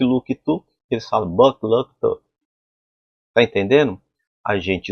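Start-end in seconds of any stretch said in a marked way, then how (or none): none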